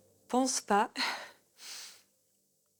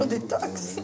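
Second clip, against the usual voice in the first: whispered smug echo; 2 semitones higher than usual. Retold or imitated. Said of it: "genre toute fière derrière".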